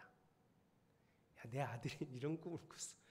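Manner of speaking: soft, low voice with a laugh in it; father secretly bragging about his son's dream; proud father chuckling. Retold or imitated. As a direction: brag quietly about your son, chuckling.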